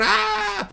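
high, softened scream; mock pained scream. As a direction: imitate screaming at a painful noise, held back. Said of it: "they're holding their ears".

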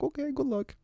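high mock cousin voice; lightly voiced cousin, brushing it off; cousin's voice.

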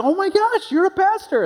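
very high, squealing excitement; hyper. Retold or imitated. Excited churchgoer gushing to the pastor, high and squealing. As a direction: mimic a fellow believer thrilled to meet a pastor. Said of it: "they'll get kinda hyper".

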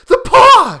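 very high, very loud shriek; frantic high-pitched outburst; wild cry. A narrator's reading. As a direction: cry out wildly at the top of the voice. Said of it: "she cried wildly".

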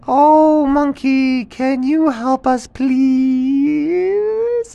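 high, loud begging animal chorus; little animals pleading with monkey. Acted. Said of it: "And all the animals said".

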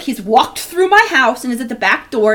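ordinary talk, nothing marked

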